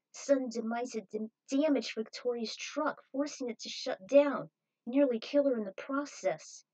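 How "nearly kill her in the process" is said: ordinary delivery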